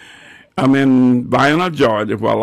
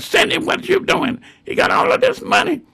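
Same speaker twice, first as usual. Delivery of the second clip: much higher mock voice of the neighbor; high tattling neighbor voice. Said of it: "So Mister Raymond Cross ... come out".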